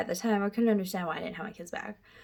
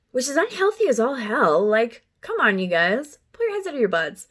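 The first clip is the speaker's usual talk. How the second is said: exasperated rant; 3 semitones higher, 6 dB louder than usual. Real, not acted. Ranting at social services.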